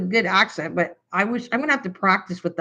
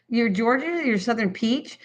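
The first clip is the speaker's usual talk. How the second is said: ordinary delivery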